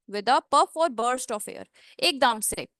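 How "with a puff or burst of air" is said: slow, bursting air on the p of "puff"; slow puff demo. Shown as a demonstration. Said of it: "with a puff of air".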